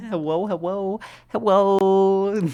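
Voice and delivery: high, loud "Hello" with the L turned into W; mispronounced L. Real, not acted. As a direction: say "Hello" as heh-WOH, L slipping into W. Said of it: "can't even say it properly".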